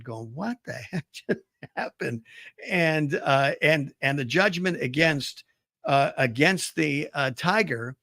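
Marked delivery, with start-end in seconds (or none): 0.3-2.2 quiet, mock-stunned disbelief; softly acted bewilderment; dumbfounded. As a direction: play it dumbfounded, voice dropped soft. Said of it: "the tiger is dumbfounded".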